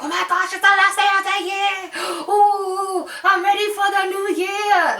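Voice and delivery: high, loud gushing squeal; over-the-top excited New Year's person. Retold or imitated. Mocking people who count down to the new year, in a high gushing squeal. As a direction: gush like someone who lives for New Year's Eve. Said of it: "'cause I just don't talk like that".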